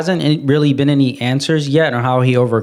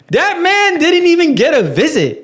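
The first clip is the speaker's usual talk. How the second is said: laughing disbelief; 11 semitones higher than usual. Real, not acted. Laughing about the ignored dad.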